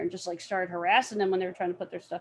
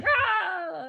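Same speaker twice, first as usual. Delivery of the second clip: mock battle cry; 9 semitones higher than usual. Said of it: "I'm just like".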